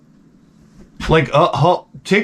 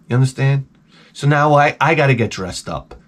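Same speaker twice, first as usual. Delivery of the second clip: ordinary talk, nothing marked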